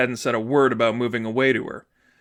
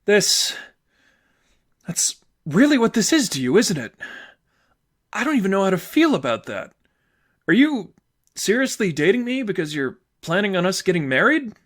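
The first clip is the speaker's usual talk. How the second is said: higher, hurt and incredulous; upset girlfriend; 7 semitones higher than usual. Acted. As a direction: ask it in disbelief, voice climbing. Sadie's voice, upset.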